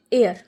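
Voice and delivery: i-r read as "air"; "air" pronunciation. Shown as a demonstration. Teacher showing how i-r is read, "air" pronunciation. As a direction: sound out i-r as "air". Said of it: "आईआर को हम पढ़ेंगे".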